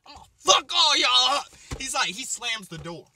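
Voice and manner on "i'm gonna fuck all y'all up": high, ending in a loud yell; mock angry threat. Mom threatening everyone in the room, building yell. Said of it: "She's like".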